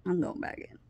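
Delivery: soft, nearly voiceless retold mutter; muttered repeat. Telling her friend she is leaving, quiet mutter. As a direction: say it under your breath.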